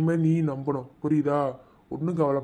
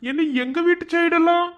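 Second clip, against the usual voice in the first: teasing greeting; 13 semitones higher, 6 dB louder than usual. Acted. Husband mockingly welcoming the Tahsildar madam, in a high sing-song voice.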